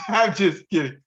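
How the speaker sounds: laughing out the words; high laugh through a joke. Real, not acted. Laughs at his own joke.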